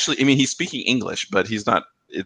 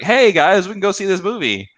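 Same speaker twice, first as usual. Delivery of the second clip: mock eager friend; 10 semitones higher, 4 dB louder than usual. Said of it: "the other friend was like".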